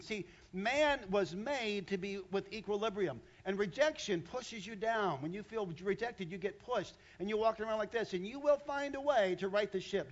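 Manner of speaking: loud, driving pulpit voice; full-force sermon climax; fiery preaching. Acted. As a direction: preach it hard to the congregation.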